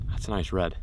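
ordinary delivery

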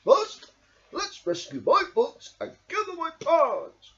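high, loud calm plan; steady Badger. A narrator's reading. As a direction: lead calmly out of trouble. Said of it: "called Badger".

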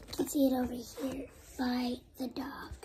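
quick whispered comment to the camera; whispered aside. Real, not acted. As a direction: rush it out in a whisper. Child whispers aside while reading.

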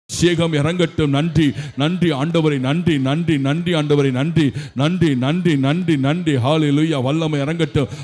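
loud, fervent proclaiming; charismatic blessing. Real, not acted. Preacher loudly calling power down on the families. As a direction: pour the blessing over them loudly.

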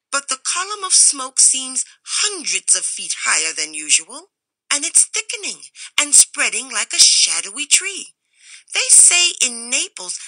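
high, loud, pressing warning; edged stranger. A narrator's reading. Stranger insists the smoke is rising, loud and edged. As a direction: build the alarm as the tree grows. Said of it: "the edged voice".

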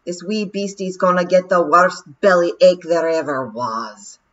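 a doomed wee warrior boasting; Rob's Scottish voice. Acted. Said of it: "he said".

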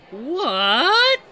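loud, high, drawn-out what; shocked. Real, not acted. Gamer reacting to Mickey's absurd line, shocked. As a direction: cry out in sheer disbelief.

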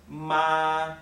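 "ma" held long on a level high pitch; "ma" in a steady, sustained high tone; first tone. Shown as a demonstration. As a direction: say "ma" high and flat, stretched out without any rise or fall. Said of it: "the first tone is a flat tone like this".

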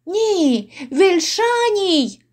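high, stretched cry; pleading confession. Acted. Innkeeper confessing to his tormentors, high pleading cry. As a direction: push it out high and drawn out.

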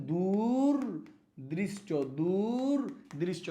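দূরদৃষ্ট drawn out very slowly; slow dictation. Shown as a demonstration. Teacher answering own question, very slow. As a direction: give দূরদৃষ্ট slowly so it sticks. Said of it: "তাহলে কি হবে".

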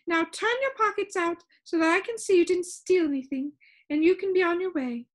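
high, brisk dismissal; impatient high-pitched Soundkeeper. A narrator's reading. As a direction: hurry the visitor along in a high voice. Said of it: "she said impatiently".

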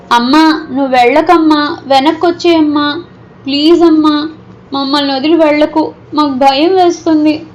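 put-on high, pleading child's cry to a mother; small kids begging her to come back; frightened children shouting for their mother; children calling out. A narrator's reading. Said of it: "పిల్లలు గట్టిగా అరవడం".

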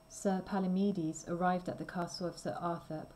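usual speaking voice

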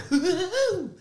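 high-pitched, bouncy Tigger hoot; Tigger's giggle. Acted. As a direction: let out a hooting Tigger laugh, way up high. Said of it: "I'm like Tigger".

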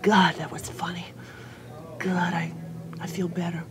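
soft whispered thanks; grateful whisper. Retold or imitated. Club-goer leaving the show thanking the comic, whispered. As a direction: hush it like a moved fan. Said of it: "comes out of the club and says".

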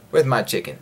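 usual speaking voice

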